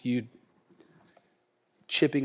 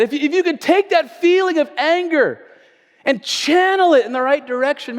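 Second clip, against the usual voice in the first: loud, high, driving sermon delivery; full-force preaching; 11 semitones higher, 8 dB louder than usual. Acted. Preacher urging church to channel anger, loud and fervent. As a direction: preach it hard to the congregation.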